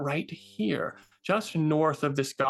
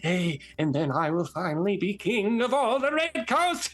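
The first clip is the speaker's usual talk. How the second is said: lightly voiced fast ranting villain; ranting goblin wizard; 4 semitones higher than usual. Goblin wizard ranting at his minions. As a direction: rant at the fools.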